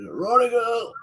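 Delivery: high, drawn-out street call; imitated vendor's cry. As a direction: sing it out high like a paper seller. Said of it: "the way the bloke shouted".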